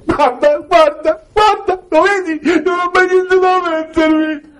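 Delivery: very high, slow, whiny mock wife voice; wife's whiny complaint. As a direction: squeal it like a wife staring at a full wardrobe. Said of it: "apre l'armadio e fa". (moglie lamentosa con voce acutissima e lenta)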